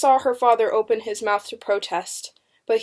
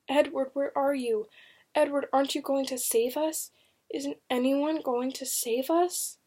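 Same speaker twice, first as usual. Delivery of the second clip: soft, higher, pleading questions; frightened inner plea; 5 semitones higher, 5 dB quieter than usual. A narrator's reading. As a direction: plead quietly with someone absent. Frightened prisoner's silent plea to Edward.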